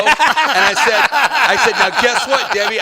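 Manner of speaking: loud, high laughing build-up; laughing, worked up. Retold or imitated. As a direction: crack up as you tell the payoff. Retelling his big moment.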